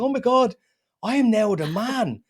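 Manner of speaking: high, startled realisation; mock shock. Comedian acting out his dawning horror, startled. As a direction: gasp it out as if you just realised you're the man. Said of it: "And you're like".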